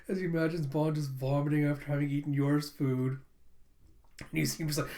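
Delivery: ordinary delivery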